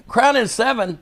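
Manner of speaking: high-pitched, disbelieving repeat; incredulous echo. Retold or imitated. Storyteller imitates Harley questioning his drink order, incredulous. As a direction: throw the order back in disbelief.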